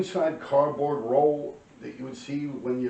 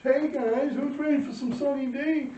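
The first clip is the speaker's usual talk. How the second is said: much higher, sing-song TV ad hostess; commercial mom voice; 4 dB louder than usual. Retold or imitated. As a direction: chirp it like a juice commercial. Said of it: "like in the commercial".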